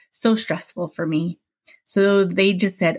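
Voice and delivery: usual speaking voice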